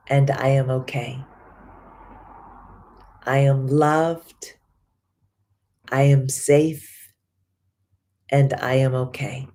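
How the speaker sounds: slow, calm affirmations with long pauses; affirmation recital. Acted. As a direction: speak each affirmation like a promise to yourself.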